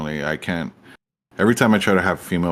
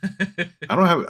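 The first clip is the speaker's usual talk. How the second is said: laughing; 10 semitones higher, slower than usual. Real, not acted. Laughing denial.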